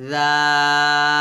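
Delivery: tongue tip lightly on upper teeth for a long, thin জাল; slow, drawn-out জাল, light dental sound; thin dhal demo. Shown as a demonstration. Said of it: "হালকা করে লাগিয়ে পাতলা করে".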